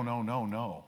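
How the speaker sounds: usual speaking voice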